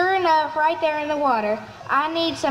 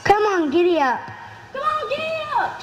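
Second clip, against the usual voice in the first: giddy-up holler rising much higher; 7 semitones higher than usual. Acted. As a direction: holler at the husband, pitch leaping up high. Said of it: "she hollered again to her husband".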